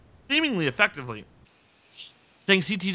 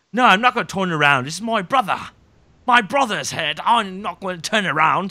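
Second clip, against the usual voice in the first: put-on British accent, higher and insistent; hammed-up British accent, a bit louder; terrible Morris; 4 semitones higher, 6 dB louder than usual. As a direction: do a bad British accent, pitched up. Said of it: "That's my terrible impression of".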